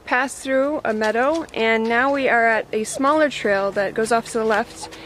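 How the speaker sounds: ordinary delivery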